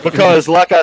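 amused, laughing as it comes out. Real, not acted. Patient recalling his hunger to the hosts, laughing.

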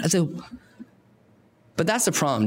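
normal talking voice

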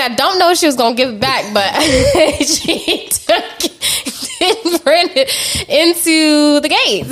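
laughing, high and slowing. Real, not acted. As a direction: giggle through it. Laughing at story.

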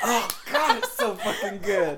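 high, loud gush through laughter; laughing delight. Real, not acted. Fan reacting to a favorite line, laughing delight. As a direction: burst out laughing as you praise it.

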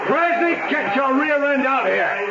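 high, furious call for Krasny; angry yelling. Acted. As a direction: bellow him out of the store. Angry owner calling out rival.

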